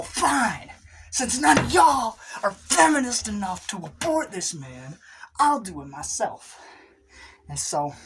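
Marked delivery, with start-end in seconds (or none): 0.0-6.6 high, loud huffy yell; high, loud indignant rant at the clinic staff; indignant shout. Acted. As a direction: shout it out, fed up and self-righteous.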